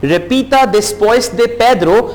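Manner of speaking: Repita después de Pedro said slowly and clearly; slow, careful spoken instruction. Shown as a demonstration. Teacher slowly tells students to repeat after Pedro. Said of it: "teacher instruction in Spanish".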